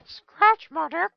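a slow, high-pitched joking voice; silly high voice. Pretend. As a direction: toss off the joke in a silly high squeak. Silly joke after a pause.

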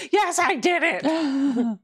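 high, slow mock triumphant reply. Voicing a viewer answering the host, high slow triumphant mock. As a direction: ham up the viewer's proud answer. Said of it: "I'm just gonna keep asking until I see".

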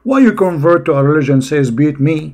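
loud, fast acted challenge; hammed-up wife's protest, rapid and loud; confronting wife. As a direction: demand an answer as the outraged wife. Acts out wife confronting her husband.

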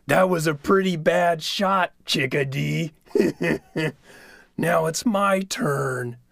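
sly pushy trickster; Coyote voice. Acted. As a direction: coax like a wheedling coyote. Coyote gloating in story.

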